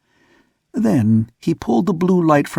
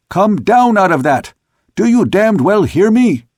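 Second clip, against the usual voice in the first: higher, louder furious command; angry shout; 7 semitones higher, 4 dB louder than usual. A narrator's reading. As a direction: bellow it up at the hiding sailor. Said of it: "but he was shouting again".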